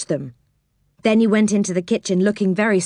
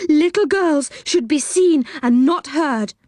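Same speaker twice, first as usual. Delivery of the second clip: horrid voice; 8 semitones higher than usual. Retold or imitated. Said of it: "in a horrid kind of voice".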